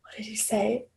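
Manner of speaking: whispered aside; whispering the question while searching her memory. Real, not acted. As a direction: drop to a breathy whisper to recall.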